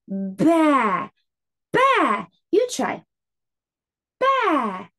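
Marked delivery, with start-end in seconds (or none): none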